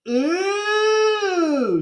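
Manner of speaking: high, long mocking ooh; teasing ooh. Ryan teasing a friend about a girl, high mocking ooh. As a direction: tease like a classmate. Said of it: "said Ryan".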